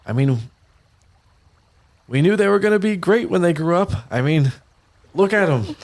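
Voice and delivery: high proud boasting voice; proud Castellan. Acted. Mother boasting to father about their children, high and proud. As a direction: brag about the kids. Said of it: "Castellan's like".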